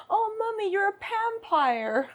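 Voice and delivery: put-on high, slow little kid voice; awed child voice. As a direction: gasp it like an amazed toddler.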